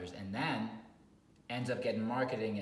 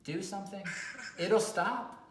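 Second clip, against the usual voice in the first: exasperated advice; 8 semitones higher, slower than usual. Retold or imitated. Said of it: "And I'm like".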